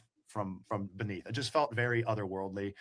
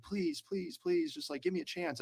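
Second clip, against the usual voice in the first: a lightly voiced woman pleading, pitched higher; imitating a pleading woman, higher; retold begging; 8 semitones higher, slower than usual. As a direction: mimic her begging, a touch whiny and high. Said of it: "she kept begging me".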